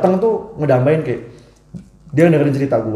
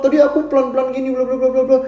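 put-on girl arguing back; 10 semitones higher than usual. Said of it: "Ceweknya ngebantah".

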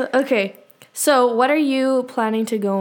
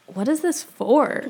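puzzled clerk; 2 semitones higher, 3 dB quieter, slower than usual. Pretend. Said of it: "the clerk just being like".

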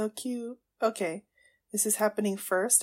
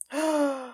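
high, delighted gasp; 5 semitones higher than usual. Real, not acted. Fan spotting squishy shield, high gasp.

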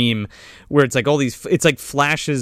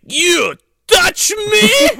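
slow, high, loud indignant cry; hammed-up outrage, every word drawn out; mock outrage; 5 dB louder, much slower than usual. Acted outrage at being grabbed.

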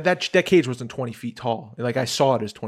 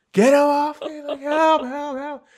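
high, wailing cry for help; hammed-up shrieking plea; mock screaming; 13 semitones higher, 4 dB louder, slower than usual. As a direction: scream like a terrified kid, fading off. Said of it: "I'm, like, screaming".

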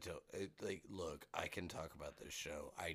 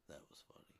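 hushed, low, half-whispered mumble; low talk; 3 semitones lower, 10 dB quieter, faster than usual. Retold or imitated. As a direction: talk it down into a breathy low murmur. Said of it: "like, low talk".

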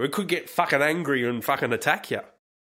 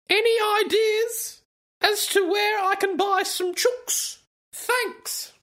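much higher mocking voice of the poster; sing-song falsetto send-up of the post; mock high reading voice; slower than usual. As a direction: parody the question, pitched way up.

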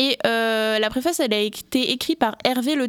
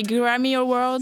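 drawn-out English pronunciation; put-on English accent on the title; much slower than usual. Shown as a demonstration. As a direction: show off an English accent slowly. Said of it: "Mon accent anglais".

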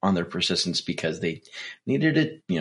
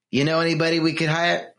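higher-pitched, badly faked Italian mobster; terrible Italian accent; 7 semitones higher than usual. Mobster asking Vinny to get daughter into acting, bad Italian accent. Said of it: "my Italian accent is terrible".

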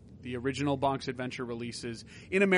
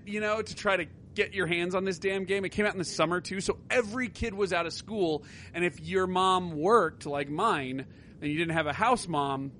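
usual speaking voice

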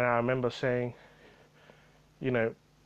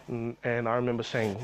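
ordinary talk

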